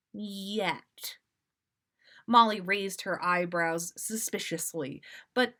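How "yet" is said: unvoiced ominous whisper; whispered yet. Molly hinting darkly at Sirius, whispered. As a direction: breathe the word like a threat.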